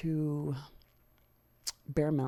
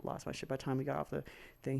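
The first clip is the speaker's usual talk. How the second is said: ordinary talk, nothing marked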